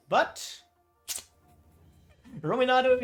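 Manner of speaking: ordinary talk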